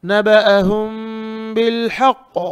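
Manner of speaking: नबअहुम बिल हक़ with long held m and throaty ha; model recitation. Shown as a demonstration. Teacher shows "like this", model recitation. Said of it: "इस तरह".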